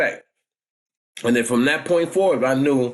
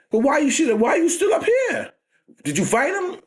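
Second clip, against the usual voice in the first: much higher, insistent aunt; scolding aunt; 13 semitones higher than usual. Retold or imitated. Aunt grilling nephew about the fight, high and insistent. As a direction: demand answers like a fierce aunt.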